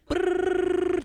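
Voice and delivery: high, slow buzzing rewind sound; rewind noise. Retold or imitated. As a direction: buzz the lips like tape rewinding. Mimics a movie rewind sound.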